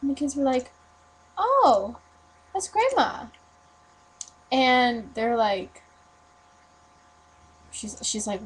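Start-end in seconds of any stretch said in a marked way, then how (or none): 1.4-3.3 put-on higher, lilting child's reply; kid's sing-song answer, imitated; voiced children. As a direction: lightly play a little kid answering. Retelling the children's innocent answer.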